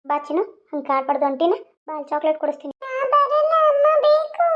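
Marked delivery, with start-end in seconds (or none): 2.8-4.5 put-on high, loud little-girl whine; whining child. Little girl demands her mother, high loud whine. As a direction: demand like a stubborn toddler.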